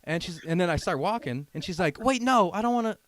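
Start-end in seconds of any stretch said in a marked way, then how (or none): 2.0-3.0 louder, higher imitation of her objecting; retold urgent protest. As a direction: cut in as her, loud and high.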